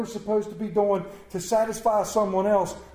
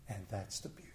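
soft near-whisper, much quieter, rising on the end; hushed; 12 semitones lower, 18 dB quieter, faster than usual. Acted. Preacher softly marvelling to the congregation, hushed. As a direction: breathe it out softly. Hushed line, then repeated plainly.

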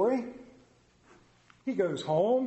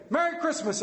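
high, bright Merry Christmas; spring a cheerful greeting on strangers; cheery greeting; 9 semitones higher than usual. Retold or imitated. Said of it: "And I say".

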